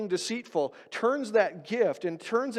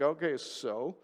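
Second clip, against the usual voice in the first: unimpressed shrug; 6 semitones lower, 8 dB quieter, slower than usual. Retold or imitated. Voicing an unimpressed listener.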